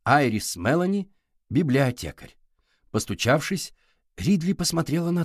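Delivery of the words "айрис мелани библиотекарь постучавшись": ordinary delivery, nothing marked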